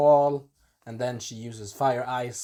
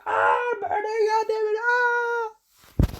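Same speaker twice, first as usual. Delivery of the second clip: extremely high hammed-up shrieks of agony; screaming, dying on fire; slower than usual. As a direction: scream the words as if burning alive. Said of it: "trying to do the voice when he was, like, dying on fire".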